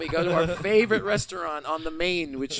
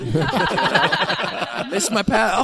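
slow, higher, louder mock baby sobbing over a lost pal; baby voice with an accent; 5 semitones higher, 7 dB louder, slower than usual. Astronaut-turned-baby missing his pal, slow mock baby voice. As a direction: stretch every word like a lovesick infant. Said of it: "I love a baby with an accent".

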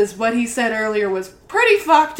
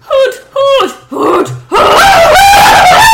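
high, loud owl hoots; owl hooting; 12 dB louder, much slower than usual. Acted. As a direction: hoot wildly, building to a shriek. Acting the owl attacking.

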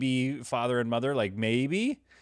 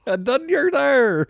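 mock Newfoundland accent; 14 semitones higher, 6 dB louder, slower than usual. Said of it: "That's really bad".

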